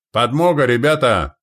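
loud, high rallying call; shout. Acted. Commander announcing reinforcements to men, shouting. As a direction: cry out to your men. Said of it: "крикнул Лонни".

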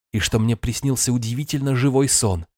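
plain reading voice

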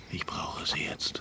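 soft whispered confession; whispered farewell words. Acted. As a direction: keep it barely audible. Intimate night talk.

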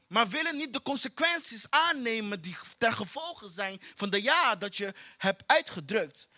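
ordinary talk

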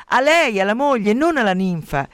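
high, indignant opening easing down; indignant outburst. A narrator's reading. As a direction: spit out the wronged wife's outrage. Giunone gelosa inveisce contro la rivale. (sfogo indignato)

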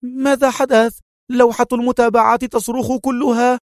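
high, urgent woman's voice; panicked head nurse. A narrator's reading. Head nurse rushes up about the alarms, high and panicked. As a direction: cry out in panic. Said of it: "في هلع شديد وهي تهتف".

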